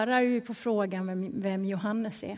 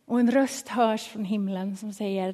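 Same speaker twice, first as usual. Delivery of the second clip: ordinary talk, nothing marked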